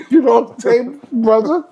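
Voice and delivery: high laughing tease. Real, not acted. Teasing his brother about the money, laughing high.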